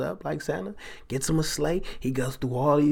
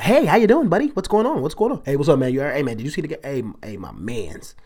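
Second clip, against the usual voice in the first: overly cheerful coworker; 2 semitones higher, 5 dB louder, faster than usual. Retold or imitated. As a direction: play the too-happy coworker at dawn. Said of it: "They're like".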